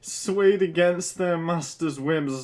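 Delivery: real lingering laughter; giggly reading. Real, not acted. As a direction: read while trying not to laugh.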